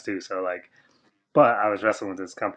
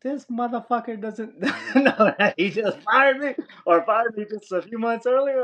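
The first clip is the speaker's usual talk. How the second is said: laughing disbelief; 13 semitones higher than usual. Retold or imitated. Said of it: "I'm like".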